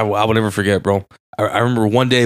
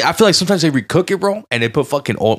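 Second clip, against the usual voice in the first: ordinary talk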